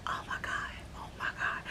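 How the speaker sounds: breathless whispered oh my God; relieved whisper. Retold or imitated. Traveler whispering relief at the kind rental agent, hushed. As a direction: whisper it in disbelief.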